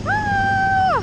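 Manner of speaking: thrilled scream. Real, not acted.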